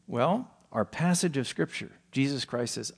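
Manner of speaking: ordinary talk, nothing marked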